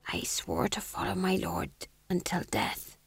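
soft, hoarse whisper; fearful fool rasping under his breath. A narrator's reading. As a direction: barely get the oath out. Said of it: "said the fool hoarsely".

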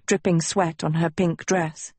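plain reading voice

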